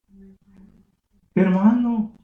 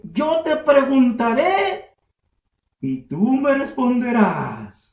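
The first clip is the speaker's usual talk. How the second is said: slow, higher divine challenge; voice of God; 5 semitones higher, 6 dB louder, slower than usual. Acted. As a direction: challenge him slowly, weighty. Voicing God to Job.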